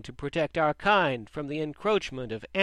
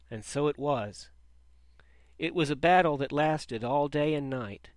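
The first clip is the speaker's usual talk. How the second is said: plain reading voice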